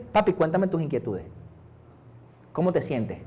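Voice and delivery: tender father. Retold or imitated. God voiced as tender father.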